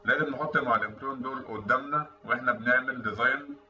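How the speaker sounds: ordinary talk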